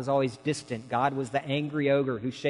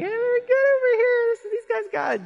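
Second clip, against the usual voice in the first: much higher, squeaky comic voice; 7 dB louder than usual. Retold or imitated. Voicing the old letter writer in a squeaky high voice.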